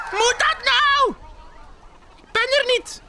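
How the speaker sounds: tiny, very high squeak. Acted. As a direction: squeak it like Bear in hiding. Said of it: "piept Beer". (hoog piepend)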